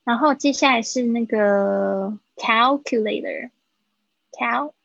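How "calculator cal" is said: slow, drawn-out calculator with the L in cal sounded; clear L sound. Shown as a demonstration. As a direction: stretch cal so its L is heard before the rest. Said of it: "记得它有一个L的声音".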